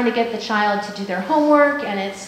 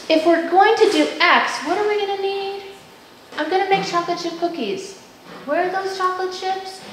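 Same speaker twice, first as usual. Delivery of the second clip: higher, puzzled, searching tone; wondering aloud; 8 semitones higher than usual. Shown as a demonstration. Mom baking cookies wonders aloud to her kids, higher and puzzled. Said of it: "appealing to the thinker ... wondering questions".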